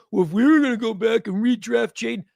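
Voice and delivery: a mock whiny fan excuse; mocking critic. Host mimicking a fan's excuse, mocking. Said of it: "And don't say, like".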